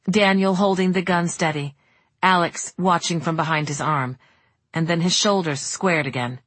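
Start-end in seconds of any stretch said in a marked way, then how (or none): none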